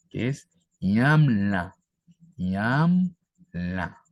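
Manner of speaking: "ñamla" stretched out slowly; slow pronunciation demo. Shown as a demonstration. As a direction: say "ñamla" slowly so they catch it. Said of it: "Miren cómo lo aplico aquí". ("ñamla" dicho muy despacio)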